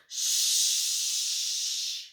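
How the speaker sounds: sharp hushing sound; cautioning hush. A narrator's reading. Man hushes Milo, a sharp warning. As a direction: warn them to be silent. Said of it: "he cautioned".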